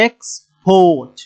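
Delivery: slow export with weight on port; last-syllable stress. Shown as a demonstration. Teacher repeats the verb example slowly, stress on port in export. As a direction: split off x, then lean long on port in export. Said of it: "port এখানে গিয়ে জোর হবে".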